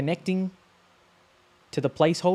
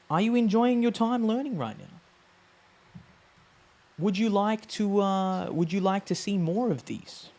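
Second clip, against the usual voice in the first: engaging viewers; 6 semitones higher, slower than usual. Real, not acted. Friendly question to viewers.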